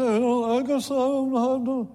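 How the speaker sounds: high, put-on whine of excuse; hammed-up helpless excuse; mock excuse-maker. Voicing a reluctant giver's excuse.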